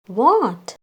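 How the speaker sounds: ordinary delivery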